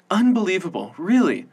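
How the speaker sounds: high, excited gush; wildly. A narrator's reading. Veronica gushing celebrity news at Matilda, wildly excited. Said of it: "Veronica said wildly".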